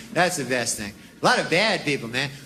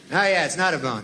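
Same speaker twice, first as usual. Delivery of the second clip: normal talking voice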